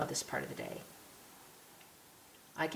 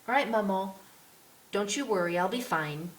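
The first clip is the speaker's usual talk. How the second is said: put-on reassuring little girl; 4 semitones higher than usual. A narrator's reading. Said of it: "Antoinette waves goodbye".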